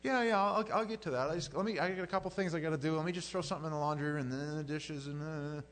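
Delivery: loud, high, hurried excuses; brushing off. Acted. Busy believer stalling the little voice with chores, hurriedly brushing off. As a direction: put someone off with a rush of chores.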